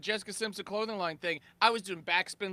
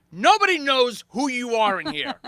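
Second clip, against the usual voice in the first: louder, menacing CEO demand; angry CEO; 5 semitones higher, 9 dB louder than usual. Retold or imitated. CEO confronting the party crasher, angry. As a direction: confront the intruder hard. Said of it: "He goes".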